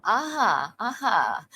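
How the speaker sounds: normal talking voice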